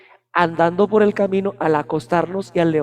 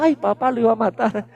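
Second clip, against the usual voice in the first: little daughter voice; 3 semitones higher than usual. Retold or imitated. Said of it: "le dramatizaba la cosa".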